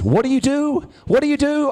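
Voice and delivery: high, puzzled mum asking; a mum who truly doesn't get it. Retold or imitated. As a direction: pitch it up like a baffled mother. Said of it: "she still says".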